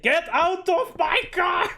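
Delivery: high, slow, loud yelled order, dragged out; furious yell. Retold or imitated. Driver screaming at Karen to leave his car, furious. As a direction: bellow it like you've lost all patience. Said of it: "feel the anger and emotion".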